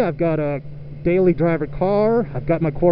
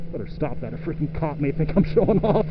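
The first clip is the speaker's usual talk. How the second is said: much softer, lower aside; hushed; 3 semitones lower, 7 dB quieter than usual. Real, not acted. Rider quieting himself so a cop won't notice. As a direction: pull the voice way down, sheepish.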